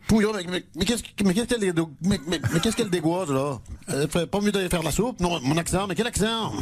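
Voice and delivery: put-on thick comic provincial French accent, stammering in indignation. Mayor protests remark on his accent, spluttering provincial French accent. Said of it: "ce joli petit accent provincial".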